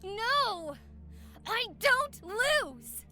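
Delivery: slow, stubborn angry refusal; angry denial. Acted. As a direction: insist she never loses. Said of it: "started getting extremely aggressive".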